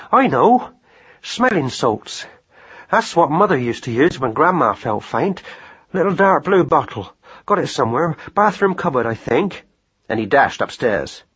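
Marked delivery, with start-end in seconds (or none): 0.1-9.7 lightly voiced countryman thinking aloud; rat-catcher's voice. A narrator's reading. Rat-catcher hunting for smelling salts, lightly voiced and hurried. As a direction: talk to yourself in short bursts of remembering.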